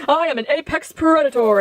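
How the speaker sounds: hammed-up declaration of power; mock villain boast. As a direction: play at being the mighty villain. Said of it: "And then he's just like".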